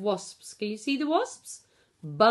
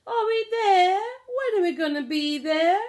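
put-on impatient child; 9 semitones higher, 4 dB louder than usual. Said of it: "Tom kept asking".

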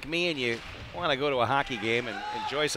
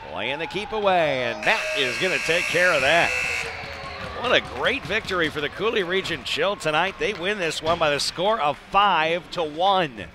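loud, higher, triumphant sign-off; excited victory call; 3 semitones higher, 4 dB louder than usual. Real, not acted. Announcer celebrating the home win for the fans, jubilant. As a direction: belt out the win for the home crowd. Final horn, home team wins.

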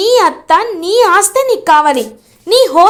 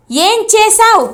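repeated higher, insistent demand; angry repeat; 6 semitones higher than usual. A narrator's reading. Chamanthi repeats to her sister, higher angry demand. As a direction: push the question up, losing patience. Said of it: "అని అడుగుతుంది చామంతి కోపంగా".

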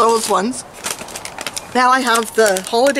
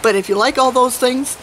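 ordinary talk

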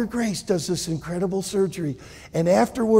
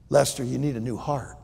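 calm doctor; 6 semitones lower, 6 dB quieter than usual. Acted. Doctor Law's verdict.

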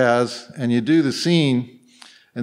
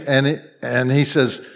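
usual speaking voice

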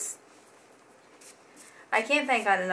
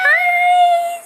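very high, loud greeting; 10 dB louder than usual. Real, not acted. Greeting baby on Skype.

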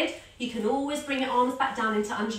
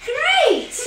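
much higher, louder laughing cheer; 13 semitones higher, 9 dB louder than usual. Real, not acted. Cheers after bike riding.

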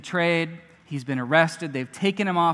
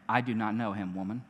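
quiet denial; 8 semitones lower, 7 dB quieter, faster than usual. A narrator's reading. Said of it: "he denied it".

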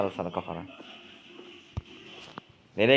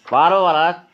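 angry shout; 4 semitones higher, 6 dB louder than usual. Acted. Heated row over a lighter.

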